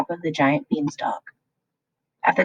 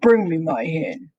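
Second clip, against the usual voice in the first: giant roaring. A narrator's reading. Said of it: "roared the greedy giant".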